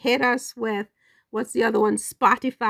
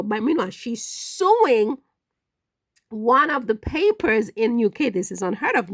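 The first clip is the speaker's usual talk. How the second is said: ordinary talk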